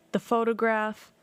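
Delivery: normal talking voice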